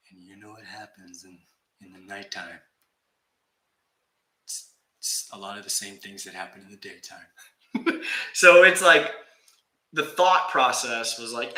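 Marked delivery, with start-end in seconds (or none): 0.1-7.3 mock spooky hush. Spooky build-up to a joke.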